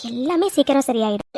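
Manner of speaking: usual speaking voice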